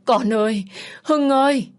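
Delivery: high, loud wail of grief; anguished scream. A narrator's reading. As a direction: cry out for a dead son in pain. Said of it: "bà Huệ đau đớn mà hét lên".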